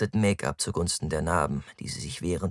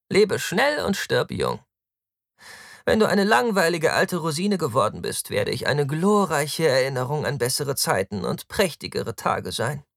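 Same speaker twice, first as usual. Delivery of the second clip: high, loud theatrical boasting; flippant, high-pitched hedonist; carefree Taktus, grandiose; 8 semitones higher, 4 dB louder than usual. Acted. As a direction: declaim your motto with swagger. Narrator voices flippant Taktus.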